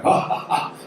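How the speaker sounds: high, breathy mock laugh; imitated group laughter. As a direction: act out the SEALs cracking up, ha-ha-ha. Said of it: "all the seals start laughing".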